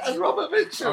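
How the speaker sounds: the granny listing names while cracking up; old lady laughing. Retold or imitated. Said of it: "she said".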